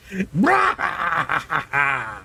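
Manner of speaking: long villainous cackle; big stagy mad laugh; hammed-up maniacal cackle. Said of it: "maniacal male voice".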